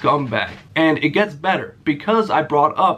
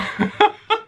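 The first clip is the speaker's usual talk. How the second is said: high burst of laughter. Real, not acted. Cracking up at own prank.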